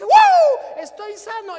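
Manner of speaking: loud high joyful shout; loud high overjoyed yell; ecstatic shout. Retold or imitated. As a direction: burst out with joy at being healed. Healed man's joy.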